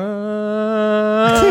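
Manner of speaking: mock baby wail. Imitating baby crying like a radio.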